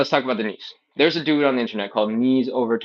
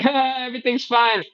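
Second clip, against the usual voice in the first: put-on high, breezy kid voice; carefree child; 13 semitones higher than usual. Child who bumped the table while playing, high and breezy. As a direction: chirp it like an oblivious happy kid.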